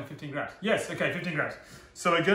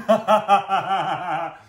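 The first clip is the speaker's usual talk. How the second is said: laughing; 5 semitones higher, 10 dB louder than usual. Real, not acted. Laughs at own mistake.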